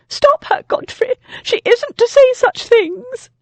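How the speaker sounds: panicked lady shrieking to her husband; hysterical. A narrator's reading. Lady covers ears, hysterical.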